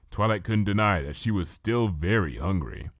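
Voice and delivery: usual speaking voice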